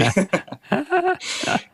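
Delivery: a burst of high squeaky laughter. Real, not acted. After joking about English Chinese food, a high laugh. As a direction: laugh up high at your own joke. Laughs at his own joke.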